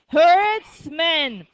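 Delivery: "herdsman" drawn out and loud; model pronunciation. Shown as a demonstration. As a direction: call out "herdsman" in two long parts. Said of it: "Say it".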